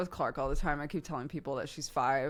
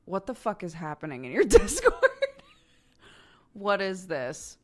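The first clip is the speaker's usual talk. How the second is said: laughing mock disbelief; 2 semitones higher, 7 dB louder than usual. Friends' shocked messages, retold.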